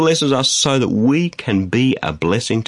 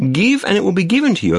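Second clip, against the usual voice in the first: higher, quicker, run together in one phrase; emphatic repeat; 9 semitones higher, faster than usual. Acted. Preacher repeating Jesus's saying for listeners, brisk and emphatic. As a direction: repeat it briskly with a raised pitch. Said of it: "Just again".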